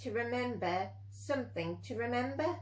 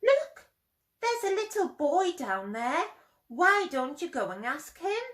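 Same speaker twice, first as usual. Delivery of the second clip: excited pointing; 6 semitones higher than usual. Acted. Mother owl points out boy.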